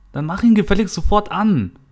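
high, loud, impatient order; angry shout. Acted. Friend demanding the TV be switched on, shouting. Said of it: "schrie sie jetzt".

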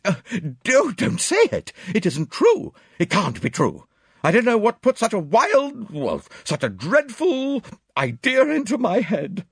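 loud, very high, frantic pleading; anguished high-pitched denial; wild, distraught. A narrator's reading. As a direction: beg wildly not to hear it. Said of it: "cried Miss Howard wildly".

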